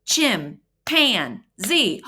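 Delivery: Chim, pan, z each beat out separately; clapping out the syllables of chimpanzee; syllables pounded out. Shown as a demonstration. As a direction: tap out Chim, pan, z one by one. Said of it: "Let's pound it out".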